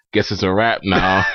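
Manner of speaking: low, slow, resigned with a laugh; defeated friend. Retold or imitated. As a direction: give up on the trip, low and slow. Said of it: "He's like".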